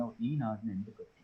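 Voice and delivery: low, hushed advertisement voice; cigarette advertisement imitation. Third man in the queue quoting the cigarette advertisement, low and hushed. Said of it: "you must have seen the cigarettes, uh, advertisement".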